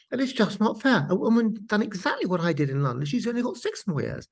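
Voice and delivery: mild mock indignation. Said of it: "she said".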